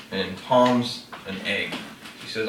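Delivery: usual speaking voice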